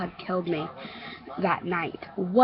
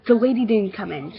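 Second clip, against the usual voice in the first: ordinary delivery, nothing marked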